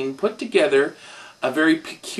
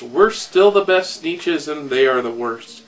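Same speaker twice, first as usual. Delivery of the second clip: ordinary delivery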